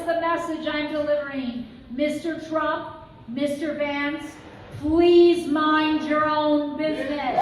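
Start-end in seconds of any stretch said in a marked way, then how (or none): 1.9-7.4 slow, loud, scolding like a strict schoolteacher; stern teacher voice. Acted. Speaker scolds Trump and Vance before a rally crowd, stern teacher voice. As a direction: draw out Mister and lay down the law. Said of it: "use my stern teacher voice".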